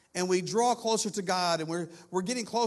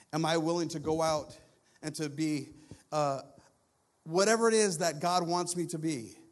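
normal talking voice

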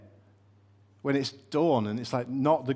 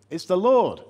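excited recognition; 6 semitones higher, 7 dB louder than usual. Acted. Disciple recognizes Jesus, exclaiming.

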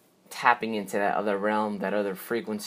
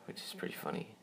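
whispered aside; 15 dB quieter, faster than usual. Real, not acted. Amused aside about launch time.

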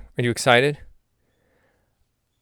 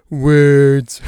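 louder words; 3 semitones higher, 9 dB louder than usual. Shown as a demonstration. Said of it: "mock podcaster at a microphone".

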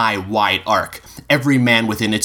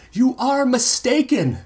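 high, slow, firm protest; shouted back; slower than usual. A narrator's reading. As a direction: insist he's wrong, calling across. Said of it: "he shouted back".

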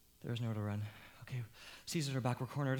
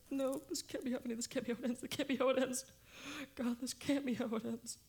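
high, tight despairing voice; despairing plea; 8 semitones higher than usual. Acted. Trapped man pleading high and desperate as the end nears. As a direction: beg against the end.

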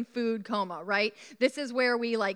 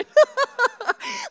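high, loud burst of laughter; real laugh bursting out high; 9 dB louder than usual. Real, not acted. Preacher cracks up at her own story, laughing loudly.

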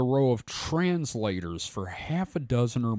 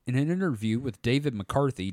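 ordinary talk

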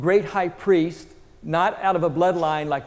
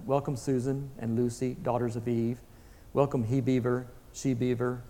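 low, softer, measured welcome; Aslan, deep and rich; 4 semitones lower, 6 dB quieter than usual. A narrator's reading. Aslan greeting the children and beavers, deep and rich. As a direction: welcome each one low and calmly. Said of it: "His voice was deep and rich".

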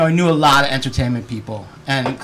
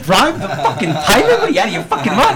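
high, laughing disbelief; incredulous; 7 semitones higher, 4 dB louder than usual. Retold or imitated. Oscar on the phone, boss said bribe the pilot, laughing disbelief. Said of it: "He's like".

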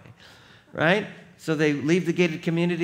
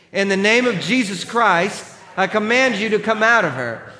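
louder, higher command of authority; 8 semitones higher, 9 dB louder than usual. Acted. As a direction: order the spirit out with force. Paul commands the spirit.

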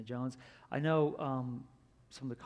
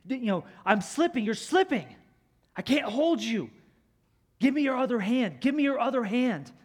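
higher, louder pleading yell; desperate shout; 9 semitones higher, 11 dB louder than usual. Retold or imitated. Indiana crying out to the falling woman, desperate yell. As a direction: beg her to grab on before he slips. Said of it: "he's crying out to her".

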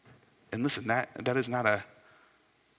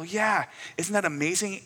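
higher, lightly enthused agreement; retold fatherly enthusiasm; 8 semitones higher, 5 dB louder than usual. Father answering his son about the stars, retold enthusiasm. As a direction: share the child's wonder, voice lifting. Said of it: "And I go".

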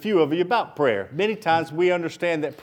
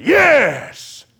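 sudden high, loud yell; explosive, drawn-out cry of assent; rousing shout; 8 semitones higher, 7 dB louder than usual. Shown as a demonstration. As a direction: shout it like a whole crowd. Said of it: "I didn't hear a bunch of people say".

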